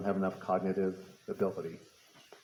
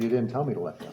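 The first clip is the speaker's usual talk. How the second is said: laughing; 7 semitones higher, faster than usual. Real, not acted. Retelling son's punchline, laughing.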